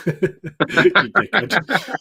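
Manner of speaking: laughing it out, high and slow; amused, drawn-out mock insult. Real, not acted. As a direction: call him a dickhead through laughter. Laughing at friend's purchase.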